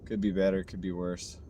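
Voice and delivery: usual speaking voice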